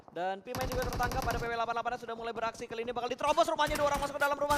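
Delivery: rapid esports call rising to a loud, high peak, then easing; live play-by-play. Real, not acted. Caster calling a house raid live, excited play-by-play. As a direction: rattle off the house push.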